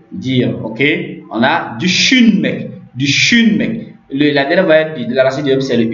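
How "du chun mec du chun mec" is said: du chun mec said slowly, chun stretched; drawn-out word model. Shown as a demonstration. Teacher giving learners a Turkish verb, slow careful model. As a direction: lean long on chun in du chun mec.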